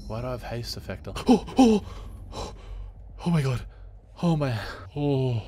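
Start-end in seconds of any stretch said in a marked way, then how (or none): 1.1-5.4 gasping, much higher and louder; alarmed gasping oh oh; startled gasps. Real, not acted. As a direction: caught off guard by a noise. Startled by a noise.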